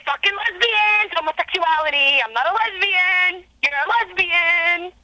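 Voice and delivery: high mock rant at ordinary volume; imitated ranting. Nurse imitating an elderly patient going off on lesbians, high mock ranting. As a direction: go off like the patient, each cry pitched high. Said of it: "went off on lesbians".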